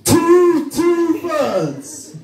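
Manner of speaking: high, loud, incredulous repeat; mock outrage. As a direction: exclaim the figure with hammed-up disbelief. Mocking repeat of the quote.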